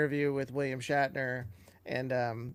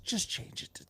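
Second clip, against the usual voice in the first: dismissive execs; 4 semitones lower, 11 dB quieter than usual. Retold or imitated. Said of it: "they just said".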